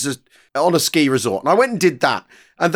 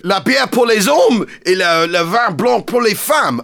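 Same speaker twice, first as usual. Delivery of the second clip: pub landlord in French; 4 semitones higher, slower than usual. Retold or imitated. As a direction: bellow it like a blokey landlord speaking French. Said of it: "It worked".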